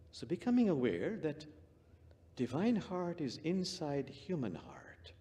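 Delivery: slow whisper. Acted. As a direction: breathe the words softly. Meditation, whispered.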